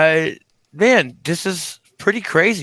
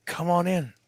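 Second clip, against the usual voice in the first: seductive come-on; 10 dB quieter than usual. Pretend. Voicing the bikini inviting.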